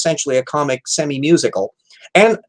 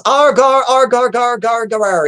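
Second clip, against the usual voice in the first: fake Scottish accent; 7 semitones higher, slower than usual. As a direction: ham up a silly Scottish lament. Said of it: "she's doing a fake Scottish accent".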